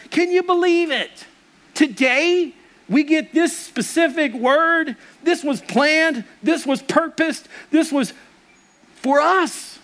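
high, slow, amazed exclaiming; excited wonder. Retold or imitated. Telling his wife on the couch, high amazed wonder. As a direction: marvel at the day's word like a discovery. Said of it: "I'm like".

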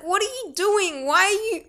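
high-pitched indignant questioning; baffled protest. Retold or imitated. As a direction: act out bewildered complaint in a high voice. Retold baffled protest to mockers.

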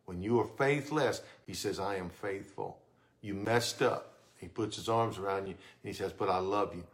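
low and very soft reassurance; whisper-close comfort from a father; tender. Acted. God's words as a hug.

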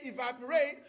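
"evaporate" said the American way; American pronunciation. Shown as a demonstration. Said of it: "You say".

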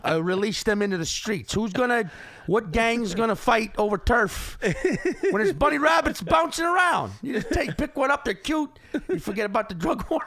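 put-on high, loud Chicago accent rant. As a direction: lay on a thick Chicago accent. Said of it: "This is a terrible Chicago accent".

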